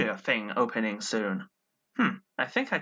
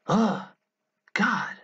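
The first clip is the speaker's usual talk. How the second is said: exasperated; 6 semitones higher, 3 dB louder than usual. Acted. Frustrated at brother's nagging.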